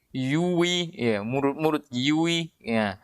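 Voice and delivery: yui ya said slowly with pursed lips; mock tongue-twisting. Shown as a demonstration. Teacher mimics how clumsy yui ya feels. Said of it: "terbalik-balik lidah mengucapkan".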